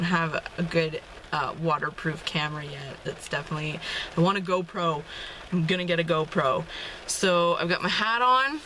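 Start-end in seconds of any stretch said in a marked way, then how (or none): none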